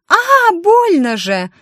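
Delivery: high, loud, slow yelp of pain; pained cry. Acted. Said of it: "вскрикнула поклонница лорда Вивера".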